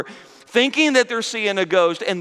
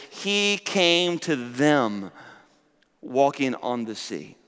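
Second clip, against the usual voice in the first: slow, drawn-out scripture words; slow reading; 4 semitones lower, 4 dB quieter, slower than usual. Acted. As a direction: savor each word slowly. Slow scripture reading, then repeated.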